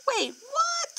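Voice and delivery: high, soft pretend double take; mock surprise. Teacher pretending to be confused, mock surprised. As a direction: act puzzled for the kids.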